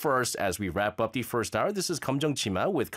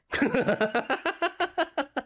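laugh; 13 semitones higher than usual. Real, not acted. Laughs after his accent demo.

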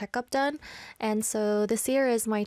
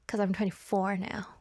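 whispered joke; 4 semitones lower, 3 dB quieter than usual. Real, not acted. Joking aside.

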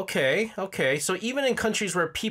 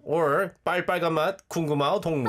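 put-on sung fast in a North Korean accent; much faster than usual. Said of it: "How does 빨간 맛 sound in North Korean accent?".

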